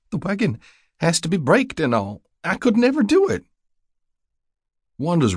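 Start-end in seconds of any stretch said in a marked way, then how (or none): 0.1-3.5 very high, distressed whine; near sobbing. A narrator's reading. Helpless widow tells rider she cannot drive, near sobbing. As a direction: protest as if tears are building. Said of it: "like she was building to another sob".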